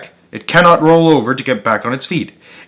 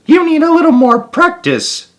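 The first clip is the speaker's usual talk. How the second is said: very high, quick little voice; squeaky mouse; 3 dB louder than usual. A narrator's reading. Scurrying mouse tells the beetle to practise, squeaky.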